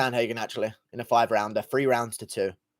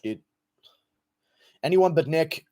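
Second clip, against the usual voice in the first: ordinary delivery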